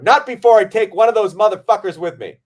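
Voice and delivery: loud, high burst of bravado; hammed-up defiant shout; mock tough boast. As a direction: crow it like an action hero going down fighting. Mock boast about his movie death.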